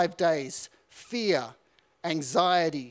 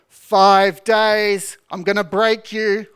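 threatening shout; 9 dB louder, slower than usual. Retold or imitated. Corporal threatens recruit.

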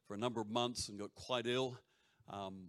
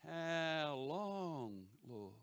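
drawn-out, slightly higher mock lament; imitated plea; 3 semitones higher, much slower than usual. Preacher imitating Melbourne friends praying, drawn-out plea. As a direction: stretch the words out, lightly imitating a weary plea. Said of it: "they'd be going".

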